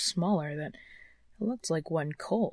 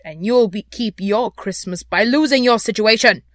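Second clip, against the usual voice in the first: loud, raised warning; irate employer's ultimatum; threatening; 3 semitones higher, 10 dB louder than usual. A narrator's reading. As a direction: threaten the clerk with force. Scrooge threatens clerk with dismissal.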